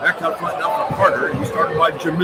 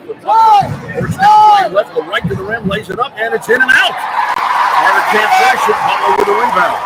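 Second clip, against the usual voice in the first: excited call; 6 semitones higher, 6 dB louder than usual. Real, not acted. Drive to the rim, missed layup.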